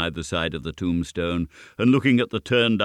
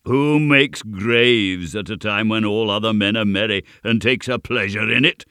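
loud, higher proclamation; goblin calling out; 4 semitones higher, 7 dB louder than usual. A narrator's reading. Goblin calls to the mysterious voices, loudly. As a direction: call the question to a whole crowd.

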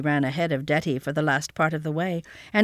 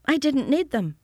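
put-on matter-of-fact child; 8 semitones higher than usual. A narrator's reading. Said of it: "as if that were quite sufficient explanation".

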